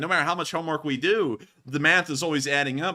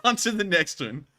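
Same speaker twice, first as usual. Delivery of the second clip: ordinary delivery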